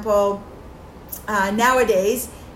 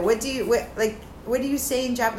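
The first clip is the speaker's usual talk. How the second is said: ordinary talk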